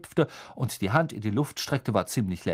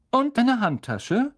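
higher, louder friendly professor voice; kind teacher; 13 semitones higher, 5 dB louder, slower than usual. A narrator's reading. Teacher explaining the spell to the class, higher and friendly. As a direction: explain patiently like a gentle teacher.

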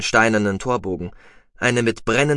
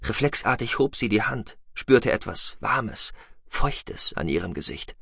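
usual speaking voice

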